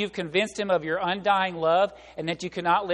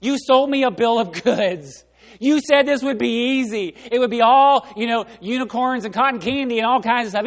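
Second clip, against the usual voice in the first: indignant complaint; 4 semitones higher, 8 dB louder than usual. Retold or imitated. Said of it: "call them up and say".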